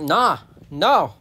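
loud, swooping hedgehog refusal; stubborn refusal. Acted. Hedgehog refusing the rescuer's warning, stubborn. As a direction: dig in with punchy one-word answers. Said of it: "So the hedgehog's like".

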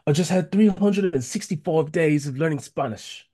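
put-on giddy friend in a rough South African accent, pitched high; high, excited mock South African accent; Aaron. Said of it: "I can't do this ac-, the accent the way he does it".

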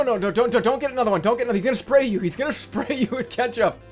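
urgent, rising alarm; panicked warning. Real, not acted. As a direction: warn him fast and frantic.

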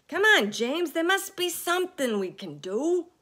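high, loud urging. A narrator's reading. Centipede urging James. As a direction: press him hard. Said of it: "said the centipede".

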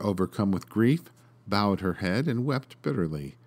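usual speaking voice

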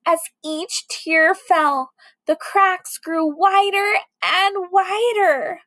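usual speaking voice

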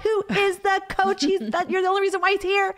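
high, loud shout at her son across the court; yelling across the gym. Retold or imitated. Mother shouts across the court to her son, high and loud. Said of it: "like, going".

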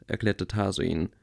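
ordinary delivery

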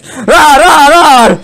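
high, playful triple roar; mock roar. Gamer roaring as the card attacks, mock roar. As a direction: roar along like a pretend beast.

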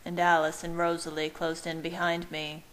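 ordinary delivery, nothing marked

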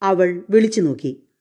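usual speaking voice

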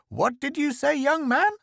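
put-on very high, piping old woman's challenge; indignant mother-in-law. A narrator's reading.

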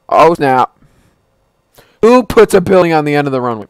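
loud alarmed yelling; panicked shout. Real, not acted. Giant building ahead, panic.

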